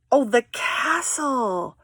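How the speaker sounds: normal talking voice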